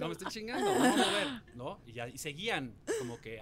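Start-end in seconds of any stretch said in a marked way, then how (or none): 0.0-1.6 loud, high, exasperated outburst; angry refusal. Retold or imitated. Contestant telling off the interview crew, angry refusal. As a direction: tell them off at rising volume.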